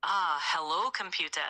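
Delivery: put-on a light Scottish accent, Scotty-style; a hint of Scottish brogue as Scotty. An AI doing Scotty's line in a light Scottish accent for a viewer. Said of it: "In a Scottish accent".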